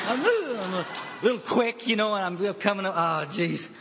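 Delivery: high, loud, laughing through the words. Real, not acted. Speaker at a meeting laughing about his detox shakes. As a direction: chuckle at your own shaky state.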